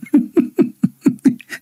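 high airy giggle; a burst of breathless, high laughter; high breathy giggle. Real, not acted. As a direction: caught off guard and giggling. Reads comment calling him anti-Islamic.

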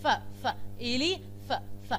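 "ف، ف" stressed as an f in the middle of "إلي"; sounding out elephant. Shown as a demonstration. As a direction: isolate the f sound of "إلي... ف" for the class. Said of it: "بي والاتش في النص نطقها ازاي".

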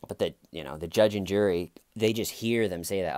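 ordinary talk

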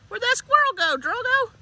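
high, teasing question to a dog; excited dog talk. Real, not acted. Egging on a dog.

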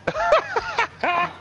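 high giggling cackle; high, tickled laugh; laughter. Real, not acted. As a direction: burst into squeaky laughter. Laughs at own silly shot.

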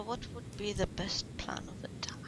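hushed, breathy strategy question; whisper. Real, not acted. Whispering to the rival across the card table. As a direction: lean in and whisper the plan.

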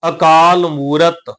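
ਅਕਾਲ ਮੂਰਤ slow, as one phrase; joined pronunciation. Shown as a demonstration. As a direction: link ਅਕਾਲ ਮੂਰਤ with long aa and oo. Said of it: "ਉਚਾਰਨ ਹਮੇਸ਼ਾ ਇਕੱਠਾ ਹੋਏਗਾ". (ਅਕਾਲ ਮੂਰਤ ਇਕੱਠਾ, ਹੌਲੀ)

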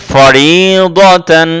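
'ফরিদাতান' slow, with a thick 'do'; heavy d. Shown as a demonstration. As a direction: recite 'ফরিদাতান' as long 'forii-do-tan'. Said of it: "মোটা করতে গেলে".

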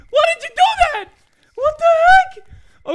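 very high, loud disbelief; outraged squeal; shocked shout. Real, not acted. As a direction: cry out in disbelief. Ally ate the hand.

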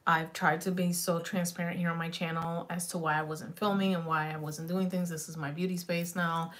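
ordinary delivery

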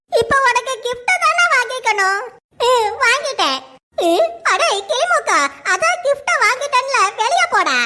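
ordinary delivery

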